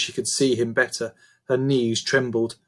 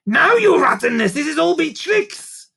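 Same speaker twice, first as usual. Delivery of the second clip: goblin warning; 6 dB louder than usual. A narrator's reading. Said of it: "Grunt said".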